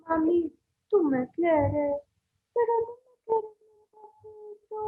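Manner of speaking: put-on a little boy asking his mom for love; high and soft, a small pleading child. Shown as a demonstration. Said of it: "Puedo hacer voz de un niñito".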